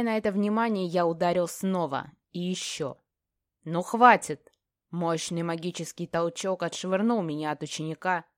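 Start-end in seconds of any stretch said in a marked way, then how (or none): 3.6-4.5 forceful, exasperated order; loud command. A narrator's reading. Sylph stopping mage beating apprentice, shouting. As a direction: burst out to stop the fight.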